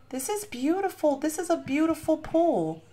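high, slow, fading to a hush; awed gushing. Real, not acted. Admiring the beautiful pool, high slow awe fading soft. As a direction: melt into quiet awe.

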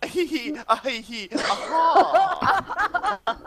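high squealing giggle as a Southern belle; high mock belle giggle. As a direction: titter up high and silly.

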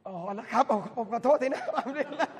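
laughing while saying sorry; laughing apology. Real, not acted. Preacher laughing as he apologises to the aunt. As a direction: chuckle through the apology and the praise.

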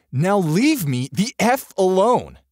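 slow, high, loud outburst; angry demand. A narrator's reading. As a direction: drive them off, stretching each word. Shoving her away in a quarrel.

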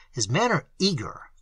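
ordinary delivery, nothing marked

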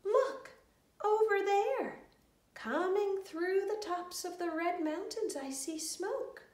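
little brother calling out excitedly. A narrator's reading. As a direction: point it out with an eager shout. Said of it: "when little brother called out".

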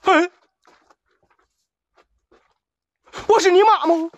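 hollering up at the sky in rough banter; yelling at drone. Real, not acted. Streamer yells up at a drone overhead, high loud shout.

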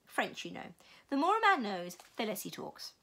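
ordinary delivery

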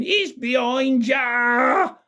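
high, drawn-out mock shout; panto audience shout. Panto audience warning Buttons of the ghost, high mock shouting. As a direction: imitate kids calling out, very high and slow. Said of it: "the audience was shouting it out".

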